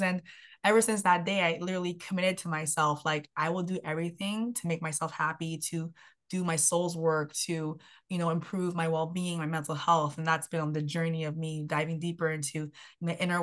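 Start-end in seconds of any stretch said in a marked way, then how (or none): none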